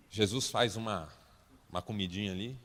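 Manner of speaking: ordinary talk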